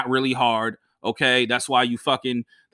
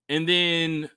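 ordinary delivery

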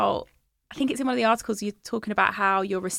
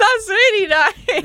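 amused mock; 13 semitones higher, 8 dB louder, slower than usual. Mocking the ironic line.

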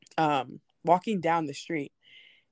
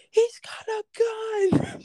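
high, slow, drawn-out alarm cry; panicked shout; much slower than usual. Retold or imitated. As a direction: cry it out like a warning in a crowd. Someone warning of a gunman.

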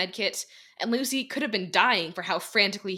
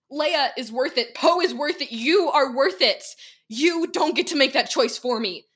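loud, high, building shout; 6 semitones higher, 8 dB louder than usual. Acted. Lucy shouting at Dekai about who is worth it, loud and building. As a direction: hammer each name at him. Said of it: "Lucy shouted back".

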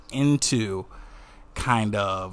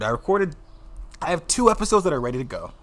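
ordinary talk, nothing marked

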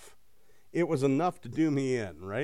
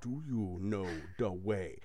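slow, throaty meme accent, softer; Ugandan Knuckles meme accent; 6 semitones lower, 9 dB quieter than usual. Shown as a demonstration. Coaching a friend on the meme voice, slow throaty Ugandan Knuckles accent. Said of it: "Say it just like".

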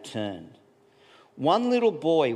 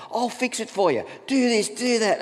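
fix-it husband; 12 semitones higher, 3 dB louder than usual. Retold or imitated. Husbands fixing wives' problems.

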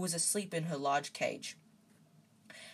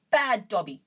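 loud, higher scolding shout; 5 semitones higher, 9 dB louder than usual. A narrator's reading. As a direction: shout it as self-punishment.

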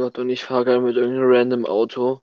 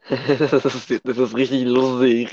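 laughing. Real, not acted. Laughing while recommending the video.

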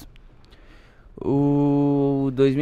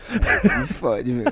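laughing; 2 semitones higher, much slower than usual. Real, not acted. Laughing at being caught.

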